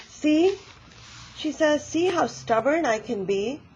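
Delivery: proud of her stubbornness; triumphant see. Acted. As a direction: grandmother proving her point. Granny ends story triumphantly.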